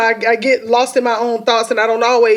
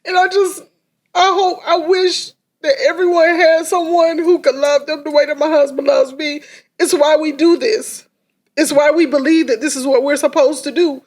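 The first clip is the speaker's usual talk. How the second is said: higher, tearful voice fighting back tears; crying; 4 semitones higher than usual. Real, not acted. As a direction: speak through real tears. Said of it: "I'm trying to preserve this makeup".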